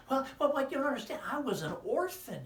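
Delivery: high, loud squeaky puppet. Acted. Puppet friend greeting the children, high and loud. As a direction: pop up cheerfully as a puppet.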